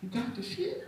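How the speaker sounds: hushed, secretive question; sly whispered ask. Retold or imitated. Old lady hustler asking the driver, hushed. Miss Gladys asking for goods.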